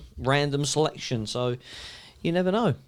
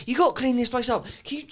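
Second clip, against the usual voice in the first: higher, bossy Jen; imitating Jen; 8 semitones higher than usual. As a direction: tell them to tidy up. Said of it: "she says about cleaning the place up".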